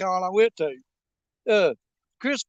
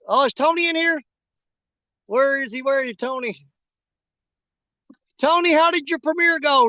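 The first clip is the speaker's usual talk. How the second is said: higher and louder, hailing a friend; calling out; 5 semitones higher, 5 dB louder than usual. Real, not acted. Looking for Tony in the chat, calling out loudly. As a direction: call across the room to find him.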